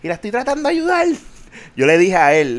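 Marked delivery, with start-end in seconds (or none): 0.0-1.1 high, quick exasperated complaint; exasperated retelling. Retold or imitated. Agent complaining about the old lady, high and exasperated. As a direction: sound fed up while still trying to help. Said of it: "Yo dije".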